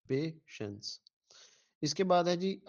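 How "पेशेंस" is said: पेशेंस softly and slowly as "pay / shens"; syllable division. Shown as a demonstration. Teacher repeating the chosen answer, पेशेंस in two slow, soft syllables. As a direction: leave a gap inside पेशेंस after pay. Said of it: "ये वाला".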